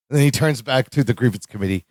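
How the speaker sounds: ordinary talk, nothing marked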